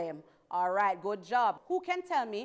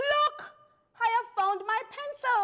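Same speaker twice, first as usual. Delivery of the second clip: high, loud cry of discovery; shouted; 12 semitones higher, 4 dB louder than usual. A narrator's reading. Pupil announcing to the class she found her pencil, high shout. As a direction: shout it out to the whole room. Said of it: "Madeline shouted".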